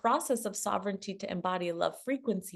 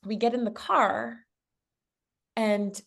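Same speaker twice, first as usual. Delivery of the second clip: normal talking voice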